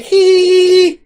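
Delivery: high-pitched, loud "hee" squeal; imitated high squeal. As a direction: squeal it out high like a little creature. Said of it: "It's like".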